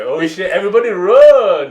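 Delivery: high, drawn-out shout of comic panic; mock alarm. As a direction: yell a hammed-up warning to a crowd.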